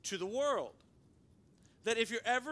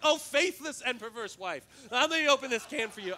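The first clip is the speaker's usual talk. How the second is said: mock-sassy scolding of his wife, louder; playfully scold, hamming up the sass; joking sassy rebuke; 3 semitones higher, 7 dB louder than usual. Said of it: "I'm joking".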